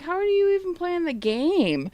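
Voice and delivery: high-pitched mock disbelief; mocking taunt. Retold taunt in online game quarrel.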